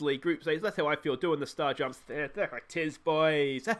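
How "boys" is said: ordinary delivery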